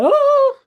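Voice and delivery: long, high, loud "oh"; imitated high-pitched howl; mock startled cry. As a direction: wail it out in mock alarm. Mimics runner caught chatting.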